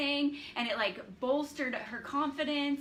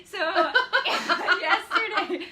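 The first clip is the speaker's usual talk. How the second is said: laughing; 7 semitones higher, 10 dB louder, much slower than usual. Real, not acted. Starts story while laughing.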